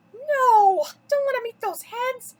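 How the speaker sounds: loud, high, furious cry of disgust; disgusted shout. A narrator's reading. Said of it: "shouted in disgust".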